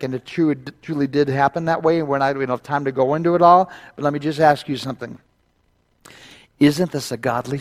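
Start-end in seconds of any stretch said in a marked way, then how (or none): none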